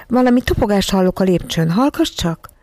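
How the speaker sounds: usual speaking voice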